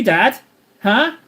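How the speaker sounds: normal talking voice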